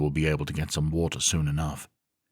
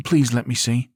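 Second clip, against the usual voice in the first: higher, louder asking woman; woman's voice; 8 semitones higher, 5 dB louder than usual. A narrator's reading. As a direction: ask politely as Zarina. Zarina asks for map.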